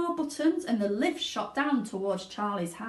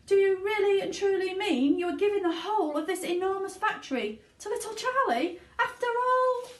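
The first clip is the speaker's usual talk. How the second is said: high, louder, astonished question; amazed; 12 semitones higher, 5 dB louder than usual. Acted. Grandpa Joe asking Wonka, amazed and high. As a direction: push it up in wonder.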